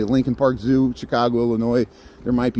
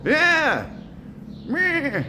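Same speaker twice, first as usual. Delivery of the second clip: much higher bleating yeah; goat-like yeah; 14 semitones higher than usual. Pretend. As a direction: answer the goats with a bleat. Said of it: "when I say yeah, I sound like a goat".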